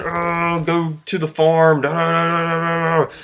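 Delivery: high-pitched mock heckling; barking twelve-year-olds. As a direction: imitate taunting kids, ending in jabber. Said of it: "twelve-year-olds barking in my ear".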